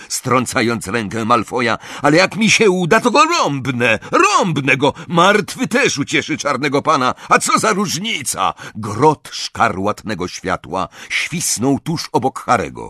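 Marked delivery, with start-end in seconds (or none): 2.0-8.5 heated, higher-pitched rant; roared retort. A narrator's reading. Crape arguing with Malfoy over killing Harry, roared retort. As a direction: argue back forcefully, pitched up. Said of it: "ryknął Crape".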